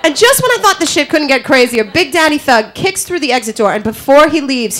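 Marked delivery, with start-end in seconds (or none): none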